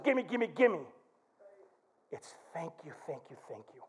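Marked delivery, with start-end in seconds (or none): 2.1-3.8 soft thank you. Shown as a demonstration. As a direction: drop to a near hush, lower. Contrast with gimme gimme.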